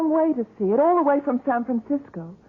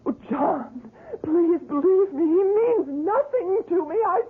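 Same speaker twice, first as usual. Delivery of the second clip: rising, breaking plea, higher and higher; pleading through tears; 7 semitones higher than usual. Acted. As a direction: beg him, voice climbing into sobs. Wife begs husband, sobbing.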